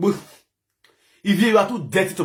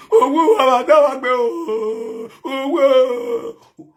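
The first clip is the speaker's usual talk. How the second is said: loud, high, drawn-out imitation wail; mock wailing; 6 semitones higher, 4 dB louder, much slower than usual. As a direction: wail like a fake mourner on live video. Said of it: "coming on live video, crying".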